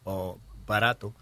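ordinary talk, nothing marked

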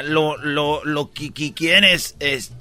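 slow, quiet, stammering; a slow stammering pharmacist; stuttering clerk. Acted. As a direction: drag out each broken syllable. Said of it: "¿Quieres otro de tartamudo?".

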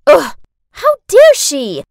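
loud, slow indignant outburst; outraged. Acted. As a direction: blurt it in outrage. Outraged at drawing.

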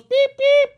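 high squeaky pip pip; squeaky cartoon beeps for a silly name; squeaky beep noise. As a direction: beep like a little machine. Teacher calls roll, joke beeps.

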